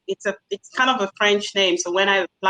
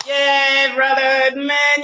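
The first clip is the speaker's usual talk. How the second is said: higher, louder, loose street greeting to a brother; casual vernacular greeting; 6 semitones higher, 4 dB louder, much slower than usual. Retold or imitated. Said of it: "having my vernacular and everything".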